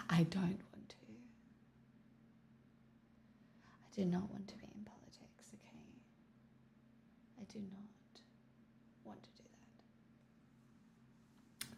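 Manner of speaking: breathy unvoiced whisper, fading to almost nothing. Real, not acted. Streamer whispering to chat that she won't enter politics, hushed. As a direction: hush it like a secret aside, dropping away at the end.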